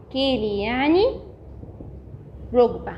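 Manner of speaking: ordinary talk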